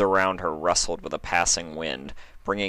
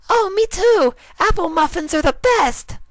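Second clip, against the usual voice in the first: extremely high, loud, bubbly pony; bursting with glee as Derpy; extremely high, louder, thrilled Derpy; excited Derpy; 7 dB louder than usual. Acted. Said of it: "Derpy gasped and jumped".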